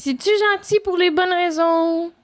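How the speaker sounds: high-pitched doubting question; suspicious questioning. Acted. Voices suspicious people. (ton méfiant, haut perché)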